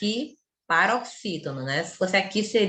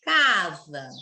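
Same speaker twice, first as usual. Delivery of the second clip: "Casa" with a held CA and quickly closed sa; teacher's drawn-out CA in "Casa"; long CA, closed za; 3 semitones lower than usual. Shown as a demonstration. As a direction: hold the first syllable of "Casa", then close off. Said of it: "No za eu já fechei".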